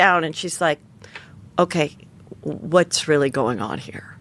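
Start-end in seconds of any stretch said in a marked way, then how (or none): 1.6-4.2 lightly voiced interrogator, low-pitched; interrogating officer. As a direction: drop low, a hint of measured questioning. Retold officer questioning her alone.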